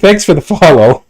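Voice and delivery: loud, high laughing thanks. Real, not acted. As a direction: thank them through a laugh. Surprised by follow alert.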